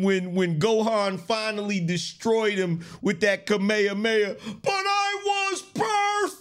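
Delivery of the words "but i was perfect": high, slow mock villain wail; Cell scream. The defeated Cell crying out his last words in a high slow wail. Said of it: "It's screaming like your boy Cell".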